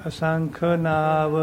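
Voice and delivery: slow "ਅਸੰਖ ਨਾਵ", drawing out "ਨਾਵ" into a pause; measured recitation halting on a long "ਨਾਵ"; held before the pause. Shown as a demonstration. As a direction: stretch "ਨਾਵ" and stop there. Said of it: "Vishram".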